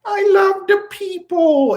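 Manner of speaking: mock Macron. Mocking Macron's speech to the people, high and slow. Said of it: "that's what Macron".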